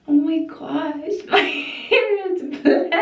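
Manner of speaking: high, slow gasp of shock; oh my gosh, stunned and laughing. Real, not acted.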